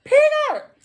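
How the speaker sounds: yell. Retold or imitated. Trapped in a room, yelling for her friend. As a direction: shout the name through the hole. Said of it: "I'm yelling out the hole".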